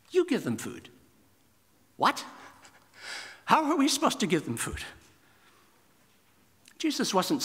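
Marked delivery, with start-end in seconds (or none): 0.1-0.9 "You" hit hard, slightly higher; emphatic YOU. Retold or imitated. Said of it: "It's emphatic". 2.0-4.8 much higher, fast, baffled; incredulous disciples. Retold or imitated.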